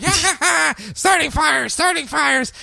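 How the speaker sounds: high, giggly imp chant; squeaky little demon singsong; gleeful fire imp. Acted. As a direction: cackle like a mischievous imp.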